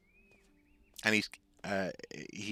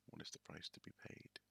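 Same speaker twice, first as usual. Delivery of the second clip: hushed whisper; whispered under the breath; 2 semitones lower, 19 dB quieter than usual. Real, not acted. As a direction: breathe it almost silently. Whispered game moment.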